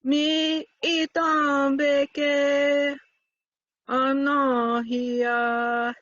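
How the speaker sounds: drawn out slowly. Shown as a demonstration. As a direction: stretch each word slowly. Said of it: "Just be like".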